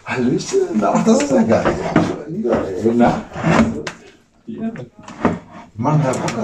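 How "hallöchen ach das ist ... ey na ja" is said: much higher, cooing to a pet; cooing greeting. Real, not acted. Greeting the newcomer, he coos in a much higher voice. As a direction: coo it up in a sing-song high voice.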